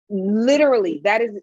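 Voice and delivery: normal talking voice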